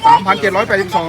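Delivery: loud, impressed reading of a total; amazed announcement. Real, not acted. Host announces a seller's rubber earnings to viewers, loud and amazed. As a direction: call the sum out loudly, impressed.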